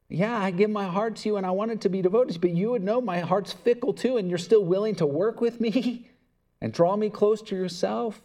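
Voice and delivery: spoken prayer, rising with feeling; earnest spoken prayer, building. Real, not acted. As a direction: pray aloud, growing fervent.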